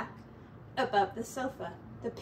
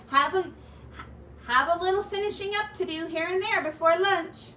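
higher, louder breezy rat neighbour; a lightly raised voice for a jolly rat; cheeky pack rat, pleased with himself; 7 semitones higher, 5 dB louder than usual. Acted. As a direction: sell him as a cheerful freeloader. Ratty's voice held in story.

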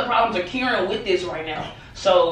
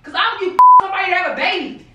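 raised, scornful retort; bitter anger; 6 semitones higher, 3 dB louder than usual. Real, not acted. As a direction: throw her words back at her. Angrily dismissing her.

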